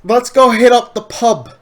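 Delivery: mock British accent. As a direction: play a posh Brit inviting a friend. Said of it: "impression of my accent".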